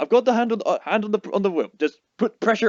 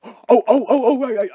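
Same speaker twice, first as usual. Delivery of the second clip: laughing; 7 semitones higher, 7 dB louder than usual. Real, not acted. Player caught off guard, laughing.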